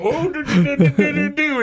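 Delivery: high, laughing do-do-do tumble; sing-song the stumble into tables; mock falling noises. Mimicking the guy staggering into the tables, laughing.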